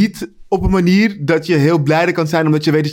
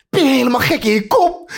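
high-pitched, mock-frantic outburst; hammed-up disbelief, voice jumping up; acted panic; 7 semitones higher than usual. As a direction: play a husband panicking at his wife. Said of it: "de paniek bij die man in zijn ogen".

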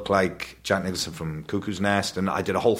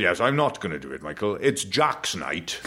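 dad's posh voice. Retold or imitated. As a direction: draw out the last line like a grand, posh father. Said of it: "And he said".

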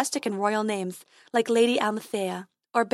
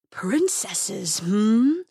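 smug dragon; much slower than usual. Acted. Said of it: "smiling and revealing".

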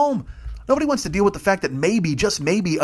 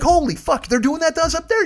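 mock outrage; 12 semitones higher than usual. Fish learning about Billy Bass, mock horrified. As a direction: squeal it in horror.

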